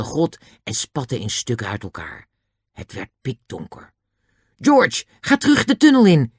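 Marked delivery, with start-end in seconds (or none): 4.6-6.3 high, loud urgent yell; shouting. A narrator's reading. Julian sends George back into the tunnel, shouting. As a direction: yell the order across the cave. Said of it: "schreeuwde Julian".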